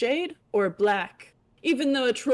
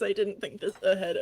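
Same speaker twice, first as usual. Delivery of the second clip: ordinary delivery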